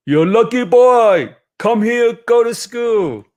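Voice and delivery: encouraging cobbler. Acted. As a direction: urge the boy like a kindly cobbler. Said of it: "he says".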